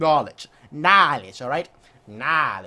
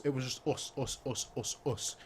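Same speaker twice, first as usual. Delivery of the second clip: softer and lower, trailing away; fading; 7 semitones lower, 13 dB quieter than usual. Real, not acted. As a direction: let the words fade out. Fading repeated word.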